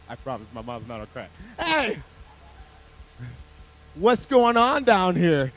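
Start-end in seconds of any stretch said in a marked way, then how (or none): 1.6-5.5 loud, drawn-out demand; hammed-up scolding mom shout; mom calling out. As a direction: holler slowly like a mom at the kids. Mom demands to know what's happening.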